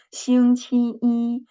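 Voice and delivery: 星期一 said slowly, 一 high and level in the first tone; model pronunciation. Shown as a demonstration. Teacher demonstrating the first tone for students, slow and deliberate. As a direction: draw out 星期一 and keep 一 high and flat. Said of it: "The first tone".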